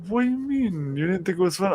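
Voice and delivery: high-pitched imitation of a taken-aback grandmother; mock surprised protest. Grandmother questions grandson about ice skating, mock surprised.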